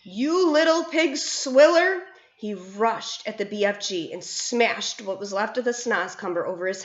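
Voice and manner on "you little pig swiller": higher, louder, slow raging roar; furious roar. A narrator's reading. Bloodbottler raging at the BFG, roar. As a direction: roar the insult at him. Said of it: "roared the blood bottler".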